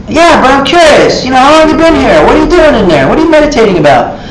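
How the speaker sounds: high, fast, eager questions; pestering visitor. Retold or imitated. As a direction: keep nagging, curious and quick.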